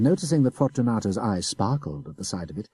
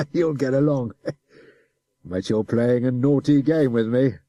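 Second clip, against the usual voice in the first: put-on chuckling, louder officer coaxing the boy; laughing adjutant; 4 semitones higher, 5 dB louder than usual. A narrator's reading. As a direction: laugh it off while threatening.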